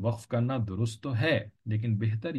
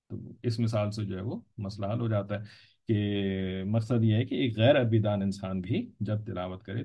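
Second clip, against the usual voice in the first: ordinary talk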